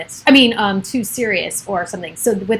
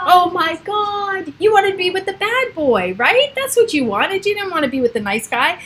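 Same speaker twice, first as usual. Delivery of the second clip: teasing; 8 semitones higher than usual. Acted. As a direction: tease her for loving the bad boy. Teasing friend about bad boys.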